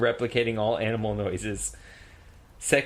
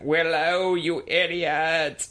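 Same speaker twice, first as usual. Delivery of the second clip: put-on goat accent; 4 semitones higher, much slower than usual. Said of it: "says with a goat accent".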